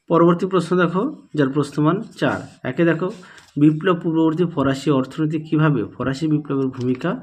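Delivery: ordinary talk, nothing marked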